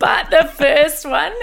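slow, laughing. Real, not acted. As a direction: still giggling as she speaks. Still laughing after the joke.

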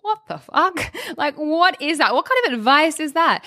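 high, incredulous outburst; shocked disbelief. Retold or imitated. Hearing inner voice say quit DJing, high shocked disbelief. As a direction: squeak out your disbelief.

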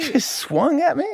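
high, indignant squeak; incredulous shark. Acted. Shark to the man who swung at it, incredulous. Acting the punched shark's disbelief.

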